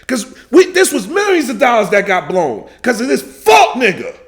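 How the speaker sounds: loud, high angry shouting; mad. Real, not acted. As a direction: vent your fury at him. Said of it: "This shit gets me mad".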